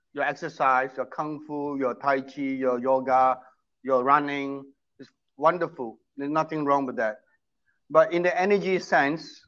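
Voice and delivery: ordinary talk, nothing marked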